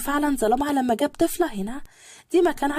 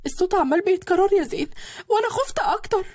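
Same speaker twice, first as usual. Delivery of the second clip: frightened cry; 6 semitones higher than usual. A narrator's reading. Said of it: "وهي صرخت".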